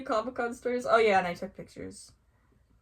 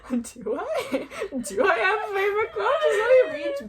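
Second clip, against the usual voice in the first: high laughing mock panic; rising, loud flustered question; flustered fangirl; 12 semitones higher, 6 dB louder than usual. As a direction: blurt it out, overwhelmed and giggly. Flustered reply to idol acted out.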